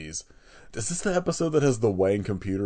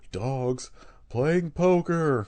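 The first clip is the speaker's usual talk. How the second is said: very slow, drawn-out high delivery; mock dramatic quote; 6 semitones higher, much slower than usual. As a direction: stretch each word out grandly. Quotes a line from the episode.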